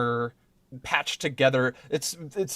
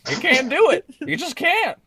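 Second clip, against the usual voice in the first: high character voice; 12 semitones higher, 4 dB louder than usual. Acted. Character voice reply.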